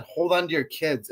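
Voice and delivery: normal talking voice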